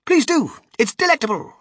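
high, loud enthusiastic invitation; eager urging. A narrator's reading. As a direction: coax them excitedly to taste it. Wonka eagerly offering his grass.

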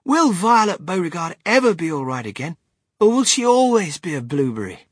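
high, worried questioning; anxious. A narrator's reading. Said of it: "said Charlie Bucket anxiously".

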